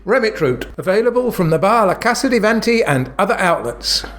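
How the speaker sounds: ad read. Acted. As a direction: sell it like a radio jingle host. Mock advert read.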